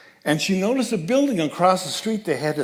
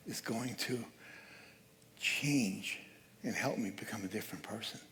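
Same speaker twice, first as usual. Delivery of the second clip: a hushed whisper, much softer; whispered hope; 4 semitones lower, 14 dB quieter than usual. Real, not acted. Preacher to congregation, hoping for change, whispered. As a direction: breathe the hope out like a secret.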